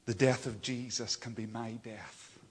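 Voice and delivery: ordinary delivery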